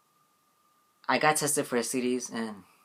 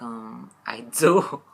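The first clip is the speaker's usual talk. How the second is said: very slow, drawn-out awkward answer; slow deadpan; 4 dB louder, much slower than usual. Retold or imitated. Patient answers the counselor, slow and deadpan. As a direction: stretch it out, deadpan and unsure. His reply to seek help.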